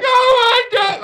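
high, loud, slow crying; sobbing joy. Retold or imitated. Overwhelmed by the coach's news, crying loudly. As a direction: wail it through joyful tears. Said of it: "hysterically crying".

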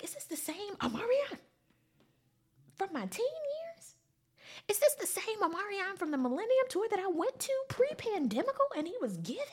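high-pitched disbelieving question; incredulous. Retold or imitated. Fan doubting this is her teen idol, high incredulity. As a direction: pitch it way up, can't believe this.

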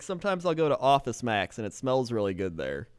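ordinary talk

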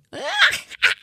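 high cackling witch laugh. Acted. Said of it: "I was hoping you could do a witch laugh".

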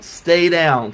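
loud shouted plea, falling at the end; retold scream. Trainer shouting at his downed fighter, loud plea. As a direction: yell it from the corner to a fallen fighter. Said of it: "Mickey is screaming to Rocky".

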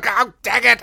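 high mock frustrated grumble; roommate grumbling. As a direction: roommate loses count, high annoyed groan. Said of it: "he'd go".

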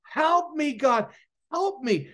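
high, pleading cry for help. Retold or imitated. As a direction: beg God for help, slowing at the end. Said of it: "I just cried out".

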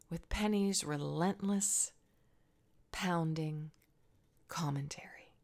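Hannah's tired thoughts on the walk to temple, whispered; inner thoughts. Acted. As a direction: whisper it like a secret burden.

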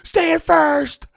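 high, slow, shouted heckle from the stands; a heckler yelling down at a slow runner, loud and mocking; heckling fan. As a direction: jeer it like a fan in the bleachers. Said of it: "the crowd's like".

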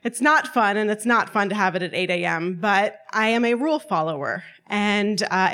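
ordinary delivery

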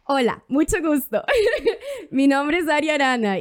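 giggling through the words; laughing. Retold or imitated. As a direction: laugh while saying it. Retelling her message, laughing.

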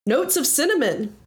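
grand, lively adventurer voice; hearty tavern regular. Acted. Leandros telling his friend his favorite drink, hearty and grand. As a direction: relish the order with theatrical flourish.

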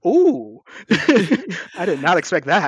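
high, loud surprised laugh. Real, not acted. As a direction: gasp and laugh, caught off guard. Co-host's surprising opinion.

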